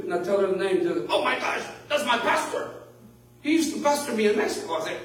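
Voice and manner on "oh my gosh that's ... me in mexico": higher-pitched, excited retelling; excited discovery. Retold or imitated. Pastor retelling a woman recognising her old pastor, excited.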